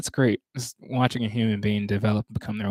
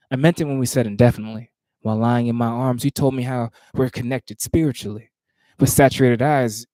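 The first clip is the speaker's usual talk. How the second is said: measured, rhythmic poetry recitation; spoken word performance cadence; spoken word poem; 2 semitones higher, 7 dB louder than usual. Acted. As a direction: perform the poem with weight on each phrase.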